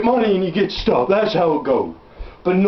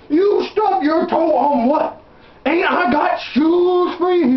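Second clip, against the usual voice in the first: slow high mock disbelief; 6 semitones higher, slower than usual. Father questioning son in story.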